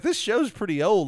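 dawning realization. Retold or imitated. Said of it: "And I was like".

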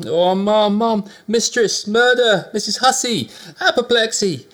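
high, loud, shrieking alarm; panicked cries. A narrator's reading. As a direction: scream for the mistress in terror. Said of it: "with these cries".

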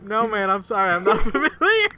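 words breaking into laughter; retold laughing reply. Yelp caller who doesn't know the film, laughing reply. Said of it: "he starts dying laughing".

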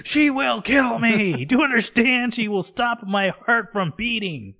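high, loud, hammed-up terror; panicked shout. Husband's escalating panic about his wife.

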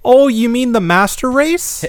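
high, slow mock-Minnesotan; hammed-up Minnesota question; Minnesotan accent. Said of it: "where that accent's going".

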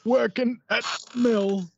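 put-on high old northern English man; northern English accent. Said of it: "one of my many great accents".

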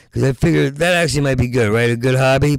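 ordinary talk

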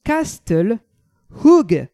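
self-mocking Flemish accent, last word punched loud; bad Flemish accent. Shown as a demonstration. Host tries the Flemish name in a bad Flemish accent. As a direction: mangle the Flemish on purpose, hitting Hoog hard. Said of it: "avec mon accent dégueulasse".

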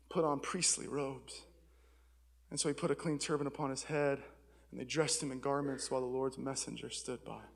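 low, soft, solemn reading; hushed, low Bible reading to a congregation; scripture reading. Acted. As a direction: read the passage quietly and evenly. Reads a scripture passage aloud.

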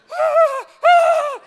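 high loud mock scream of fright; very high loud imitated shriek. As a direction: shriek like she saw a rat. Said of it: "She's like".

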